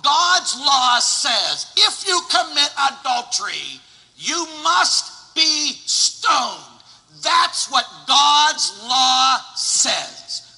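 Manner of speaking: loud, slow, high-pitched pulpit thunder; full-force sermon delivery; fiery preaching. Acted. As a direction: preach it hard and slow to the whole church.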